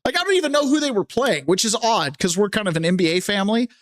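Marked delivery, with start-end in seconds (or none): 0.0-1.4 sharply higher, dismissive; exasperated. Real, not acted. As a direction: brush the question off, fed up. Exasperated answer to a repeated question.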